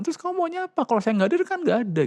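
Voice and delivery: high, fast protest from the father; exasperated father. Retold or imitated. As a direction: snap back like a cornered dad. Father voiced arguing back.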